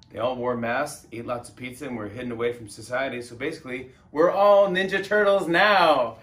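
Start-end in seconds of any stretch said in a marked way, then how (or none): 4.1-6.2 loud, high, drawn-out proclamation; mock-triumphant holler to the crowd; triumphant shouted punchline. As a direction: belt out the punchline, stretching the words.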